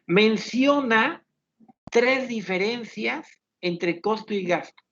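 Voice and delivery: menciona stretched slowly, tres diferencias spelled out; slow precise instruction. Shown as a demonstration. Teacher reading an exam instruction to the class, slow and deliberate. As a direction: dictate it like a careful exam instruction. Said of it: "Por ejemplo".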